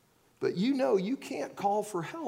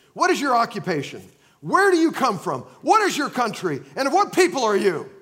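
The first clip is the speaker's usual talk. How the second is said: louder rapid-fire questions, a hint of an interrogator; interrogating sailors; 4 semitones higher, 8 dB louder than usual. Sailors questioning Jonah in an interrogation, pressing louder questions. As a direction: grill him like a suspect. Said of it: "an interrogation room".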